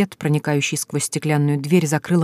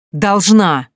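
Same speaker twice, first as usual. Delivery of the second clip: loud, hard one-word bark; angry retort; 3 semitones higher, 6 dB louder than usual. A narrator's reading. Husband answering before slapping her, angry bark. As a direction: slam the word down.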